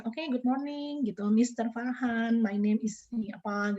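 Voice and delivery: slow, careful English greeting; mock interviewer speaking slowly in English. Shown as a demonstration. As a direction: interviewer demos a slow formal English opening. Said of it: "kita menggunakan bahasa Inggris ya".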